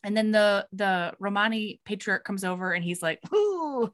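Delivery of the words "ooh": high mock ominous ooh; a knowing old man's ooh, pitched up; patriarch's ooh. Patriarch voiced in retold story.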